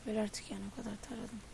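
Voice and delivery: quiet grumble. Real, not acted. Gamer grumbling "Öl artık" to himself, quiet and weary. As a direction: mutter "Öl artık" in weary defeat.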